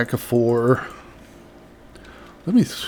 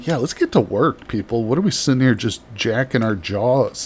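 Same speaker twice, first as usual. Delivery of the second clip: ordinary delivery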